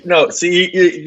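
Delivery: ordinary delivery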